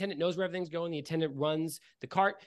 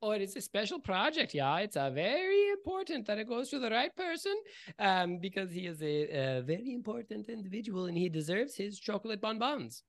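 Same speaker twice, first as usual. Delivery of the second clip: Isaac voice; 5 semitones higher than usual. Acted.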